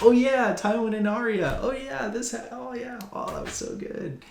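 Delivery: high, gushing fan delight; excited gushing. Retold or imitated. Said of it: "and you're like".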